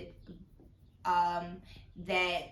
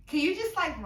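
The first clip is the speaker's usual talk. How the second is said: excited request; 9 semitones higher, 7 dB louder than usual. Real, not acted.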